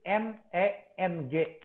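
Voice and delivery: letters "N E N G" slow and apart; spelled out. Shown as a demonstration. Teacher spells the name out slowly. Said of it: "nama Neng itu ditulis".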